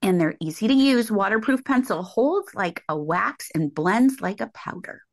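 ad read. Real, not acted. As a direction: read the makeup copy gently to listeners. Reads a sponsor ad.

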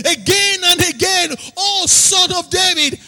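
rising sermon chant, calling out to the congregation; fiery preaching. Acted. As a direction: preach it at full force over the music. Preaching at full force.

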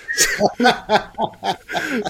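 real hearty laugh. Real, not acted. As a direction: burst of high laughter. Laughs at being fooled.

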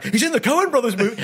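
high, loud incredulous echo; shocked disbelief. Retold or imitated. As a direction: repeat it in stunned disbelief. Shocked realisation retold.

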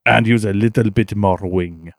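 hammed-up French accent giving car advice; mock French coach with a lofty tone; Sebastian Loeb French accent. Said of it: "Oh, Sebastian".

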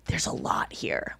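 slow hushed whisper; whispered aside. Retold or imitated. Friend confiding there's a lot here, hushed whisper. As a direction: breathe it out low and slow like a secret. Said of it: "she's like".

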